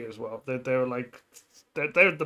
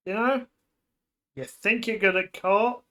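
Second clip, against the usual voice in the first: mock challenge; 5 semitones higher, 5 dB louder than usual. Streamer challenges Yendo in story.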